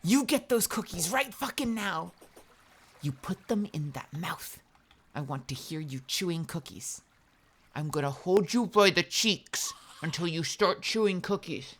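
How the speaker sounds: commanding, teasing voice; bossy seductive. Pretend. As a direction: play the demanding seducer. Playful dominatrix bit.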